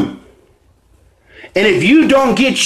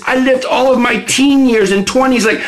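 normal talking voice

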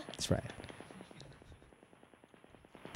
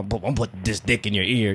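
lightly voiced lowered sleazy line; a hint of a low sleazy guy; obnoxious dirty talk; 10 semitones lower than usual. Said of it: "Don't be like".

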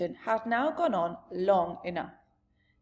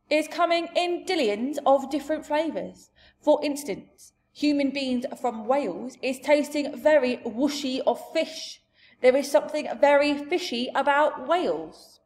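loud, raised giant voice; big giant voice, higher and louder; expounding giant; 6 semitones higher, 6 dB louder than usual. Acted. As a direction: hold forth as a blustering giant. Giant explains human flavours.

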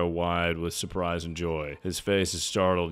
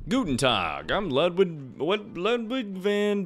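high Beethoven voice; 11 semitones higher than usual. Acted.

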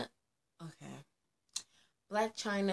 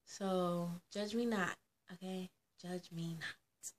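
hushed; 8 dB quieter than usual. Acted. Dramatic hushed plea.

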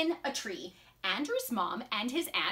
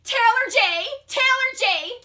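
high, loud hail to a friend; calling out; 9 semitones higher, 8 dB louder than usual. Acted. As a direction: shout urgently for your friend. Andrew calling his friend.

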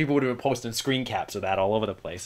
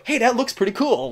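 lightly voiced careless filmmaker, higher; mock filmmaker; 10 semitones higher than usual. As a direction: parody a casual shrug of a decision. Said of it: "They go".

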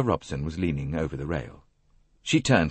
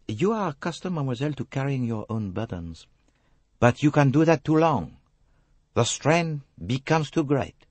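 put-on grave French-accented detective, higher and deliberate; Hercule Poirot; 8 semitones higher than usual. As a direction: press her earnestly in a French accent.

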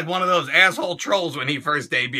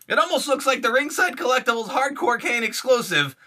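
usual speaking voice

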